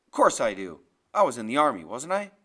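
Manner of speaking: low, loud, forceful comeback; indignant retort. Acted. Grim retorts in the quarrel.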